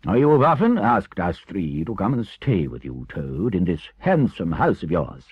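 deliberate, commanding elder; firm, stern Badger. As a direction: lay down the law with authority. Badger takes charge and gives orders.

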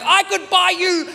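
can't believe the price, stretch it out; incredulous. Retold or imitated.

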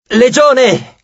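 high rallying call; commander's shout. A narrator's reading. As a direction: summon the legion. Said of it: "gridò Reina".

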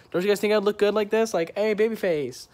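high, loud preening voice; mock flirty pose.

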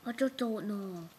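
hammed-up mock-Italian accent; garbled cartoonish accent, somewhere near Italian; muddled put-on accent. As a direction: do a muddled, stagey foreign accent. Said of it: "what even that accent's trying to be".

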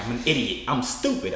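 ordinary delivery

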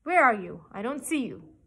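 loud, indignant royal demand; king's cry. A narrator's reading. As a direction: shout at an unseen intruder. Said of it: "cried the king".

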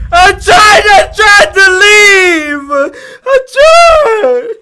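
real tearful yelling, high and loud; shouting back at the ghost in distress; crying shout. Real, not acted. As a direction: plead desperately through tears. Pleading with the ghost.

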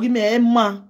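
ordinary delivery

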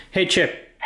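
high sing-song call to dog. Real, not acted. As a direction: call the dog's name. Calling commands to robot dog.

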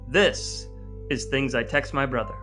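ordinary talk, nothing marked

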